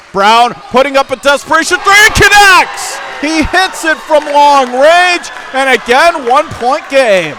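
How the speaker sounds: rising to a high, loud shout as the three drops; excited call. Real, not acted. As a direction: yell it as the shot connects. Late three connects, crowd moment.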